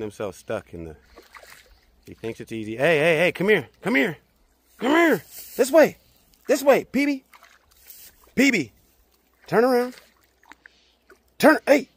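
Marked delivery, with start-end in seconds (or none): 2.8-11.9 loud, high repeated calls to the dog; calling out. Real, not acted. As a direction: shout to bring him back, louder each time. Calling dog to turn around.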